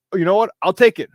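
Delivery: ordinary talk, nothing marked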